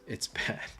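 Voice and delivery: says it through a small laugh; a half-laughed scold. Acted. To the audience, the verdict chuckled.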